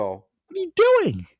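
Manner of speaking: high, slow, incredulous outburst; exasperated what are you doing. Retold or imitated. Ranting about overhead bin hogs, high incredulous outburst. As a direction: stretch it out in disbelief at a jerk.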